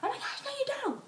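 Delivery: very high mock fangirl squeal; teasing excitement. Teasing Taryn during the break, excited squeal. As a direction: squeal it to wind up a friend. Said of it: "I was just teasing Taryn".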